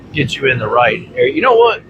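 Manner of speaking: normal talking voice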